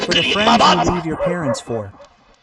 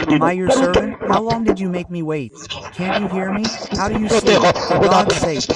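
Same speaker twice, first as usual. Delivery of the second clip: angry shouting son; 3 semitones higher, 4 dB louder than usual. Retold or imitated. Son yelling at his old mother at the door, furious and loud. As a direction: bark at the old mother for making you wait. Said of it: "you started pouring fire".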